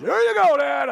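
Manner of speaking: high, loud triumphant yell; excited shout. Retold or imitated. Son shouting to his dad after the eagle shot. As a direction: yell it like you just won. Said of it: "Max goes".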